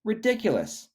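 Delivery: high scoffing bird voice; lightly birdy voice mocking Shelley; cheeping bird. A narrator's reading. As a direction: chirp out the scorn. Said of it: "cheeped the bird".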